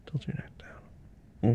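slow soft whisper. Acted. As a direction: gently whisper the direction. Whispered instruction.

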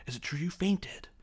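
high, whispery put-on taunt; Malfoy. Schoolboy asking Harry about fainting, high whispery taunt. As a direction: needle a rival in a high half-whisper. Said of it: "and he goes".

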